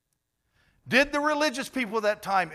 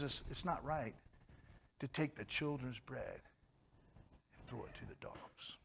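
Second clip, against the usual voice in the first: much softer, sinking to a whisper at the end; hushed whisper; 4 semitones lower, 17 dB quieter than usual. Acted. Jesus answering the kneeling woman in a hushed whisper. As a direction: let the hard words drop almost to nothing.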